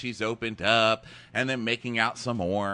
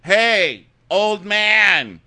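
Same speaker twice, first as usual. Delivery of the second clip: louder, higher dismissive holler; mock gruff call; 6 semitones higher, 7 dB louder, much slower than usual. Friend voicing dumped guy hailing the old man, loud mock holler. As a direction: brush her off and call out. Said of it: "It's like".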